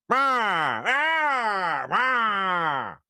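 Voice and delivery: high growly put-on bellow; Tony Clifton impression. Dad showing how his angry toddler sounds, Tony Clifton bellow. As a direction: bark it like a lounge-singer grouch. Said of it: "That's what she sounds like when she's angry".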